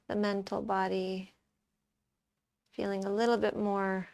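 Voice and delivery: soft, calm meditation voice; guided meditation. Acted. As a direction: guide them quietly into watching the breath, with long pauses. Leads a breath meditation.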